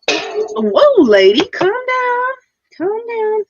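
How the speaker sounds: high, loud startled cry. Real, not acted. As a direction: yelp in surprise at a jumping cat. Startled by the cat.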